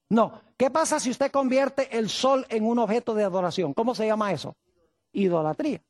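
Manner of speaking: ordinary delivery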